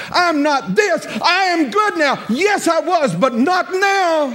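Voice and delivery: rising to a shout of defiant self-worth; shouted declaration. Acted. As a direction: proclaim it, high and shouting.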